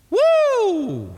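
high loud whoop; high excited woo; preacher's whoop. Real, not acted. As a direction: let out a joyful whoop. Preacher's exclamation.

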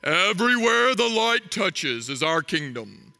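a father solemnly showing his son the kingdom; Mufasa. Acted. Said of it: "Mufasa is standing on top of Pride Rock".

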